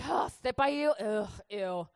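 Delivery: mock disgusted teenage scoff; exasperated, hammed-up disdain; irritated teen. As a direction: huff it out, then drop to a grossed-out "ew". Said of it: "I was so irritated".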